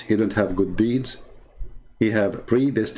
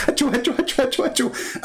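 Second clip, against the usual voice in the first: high squeaky sneezes trailing to a breathy finish; cartoon achoo fading away; fake sneezes; 14 semitones higher than usual. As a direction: sneeze wildly then fade.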